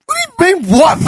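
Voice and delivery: mock outraged demand at the other driver; retold incredulous yell. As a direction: replay the disbelief at the driver. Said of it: "I go".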